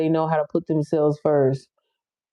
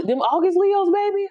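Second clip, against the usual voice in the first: excited; 11 semitones higher than usual. Real, not acted. Hyping August Leos.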